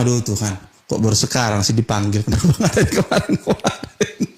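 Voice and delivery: mock relief dissolving into laughter. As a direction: quote the gossip, breaking into a laugh at the end. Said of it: "Orang akan bilang".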